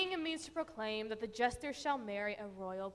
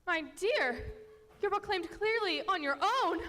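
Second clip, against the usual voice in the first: high, loud and fast rebuke; queen scolding the king for proclaiming alone; exasperated scolding; 8 semitones higher, 4 dB louder than usual. Acted. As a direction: snap at him in exasperation.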